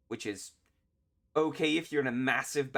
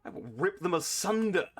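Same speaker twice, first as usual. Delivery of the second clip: hammed-up boast, higher-pitched; mock-menacing reply; 6 semitones higher than usual. Player answering the briefing's order to delay, hammed-up menace. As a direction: declare it with mock bloodlust.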